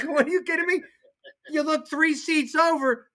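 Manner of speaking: incredulous. Retold or imitated. Retelling the stuffed players on the bus, high mock disbelief.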